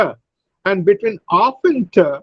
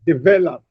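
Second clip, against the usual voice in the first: "develop" with VEL stressed; stress on second syllable; faster than usual. Shown as a demonstration. Teacher models "develop" for students, stressing VEL. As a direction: lean on the middle of "develop", de-VEL-op. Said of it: "The second syllable is stressed".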